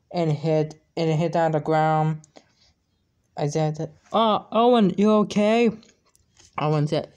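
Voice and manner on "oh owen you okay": worried call. Acted. Friend hit by ball.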